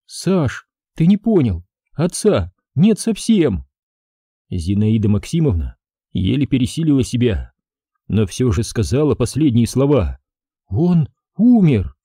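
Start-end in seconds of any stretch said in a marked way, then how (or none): 0.1-3.7 high, slow pleading grief; a mother forcing out the truth; tearful insistence; anguished insisting. A narrator's reading. 10.7-11.9 high, slow anguished cry; the final hard words wrenched out; breaking cry. A narrator's reading. Mother finally says father died.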